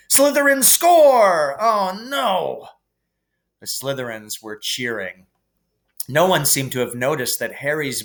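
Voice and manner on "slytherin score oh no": high, loud, drawn-out call of dismay; dismayed commentator shout. A narrator's reading. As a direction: cry out the score in horror. Commentator reacts to opposing goal.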